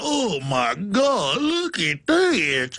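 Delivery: slow, drawn-out pretend horror; mock panic. Imitating state police at a find, mock slow horror. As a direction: draw it out in hammed-up dread. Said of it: "literally going".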